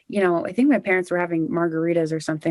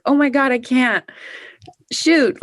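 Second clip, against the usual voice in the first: mock dismay; 6 semitones higher, 5 dB louder than usual. Retold realizing she can't go.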